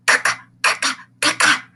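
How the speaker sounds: put-on kids cawing like crows; a flock of crows cawing; crow caws. Kindergartners caw the letter C like crows. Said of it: "like a flock of crows".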